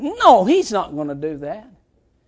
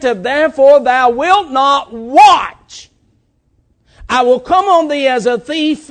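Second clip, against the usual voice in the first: slow, solemn preached scripture; scripture preaching; 7 semitones higher, 10 dB louder than usual. Acted. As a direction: declaim the verse to the congregation.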